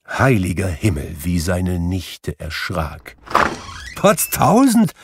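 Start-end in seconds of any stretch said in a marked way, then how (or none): 3.9-4.9 very high exclamation of surprise; startled, high-pitched call; astonished cry. A narrator's reading. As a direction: burst out in amazement at a visitor. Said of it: "rief Fred".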